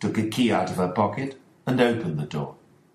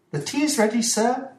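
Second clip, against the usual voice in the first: put-on much higher young woman's voice; maid Dolores. Dolores the maid announcing tea, high and polite.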